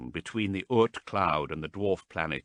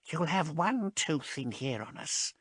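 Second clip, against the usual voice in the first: high, soft worried plea; frightened warning; 10 semitones higher, 3 dB quieter than usual. A narrator's reading. Marast warning Haas fearfully, high and soft. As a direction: urge her to stop, scared.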